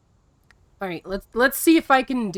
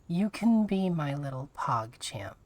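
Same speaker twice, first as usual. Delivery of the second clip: a low, softer, doting mommy voice; 6 semitones lower, 5 dB quieter than usual. Pretend. Streamer reading a chat redemption to viewers, doting mommy voice. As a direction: coo it like a doting mom, a touch hammed. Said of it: "how to say that in the mommy voice".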